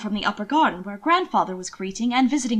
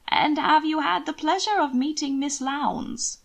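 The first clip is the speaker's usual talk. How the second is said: slow, higher, falsely innocent question; 6 semitones higher, slower than usual. A narrator's reading. Aunt Margaret probing Hannibal and Will, mock innocent tone. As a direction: feign innocence while fishing. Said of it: "her innocent tone".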